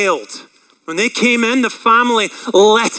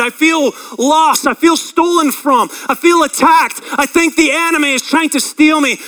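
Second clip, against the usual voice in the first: raised, higher desperate cry; calling out; 6 semitones higher than usual. Acted. Lost sheep calling out to the shepherd, raised and pleading. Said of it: "You're calling out to the shepherd".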